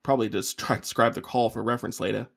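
ordinary talk

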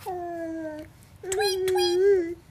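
tiny high-pitched chirps; bird tweeting. A narrator's reading. Little pig up in a tree, tweeting like a bird.

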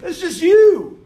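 high, loud, drawn-out retort; mock exasperation. Hyde scoffing at Eric's imitation, in loud mock exasperation. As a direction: act out debunking a bad impression. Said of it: "And he goes".

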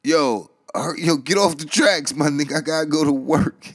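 high, loud hollering at the guy; impatient yelling. Retold or imitated. Commuters yelling at man on the tracks, loud and high. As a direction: shout him off the tracks, annoyed. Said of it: "people in the background like".

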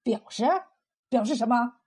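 understated cold sneer; lightly mocking, sceptical; sneering question. A narrator's reading. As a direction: toss it back with scorn. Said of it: "冯三喜冷笑着问".

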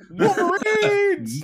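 drawn-out high shouted cheer; mock battle cry. Podcast host hyping the show, mock battle cry. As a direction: holler it high, stretching the word out.

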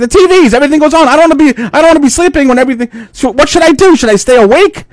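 high, rushed panicky whine; panicky daughter. Retold or imitated. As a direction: spill out the fears fast. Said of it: "She's like".

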